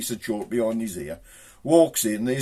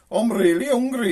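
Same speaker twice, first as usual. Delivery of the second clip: high, pleading tiny creature; small hungry snail, pitched up; little snail voice; 11 semitones higher, 5 dB louder than usual. Acted. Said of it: "he hears this little voice".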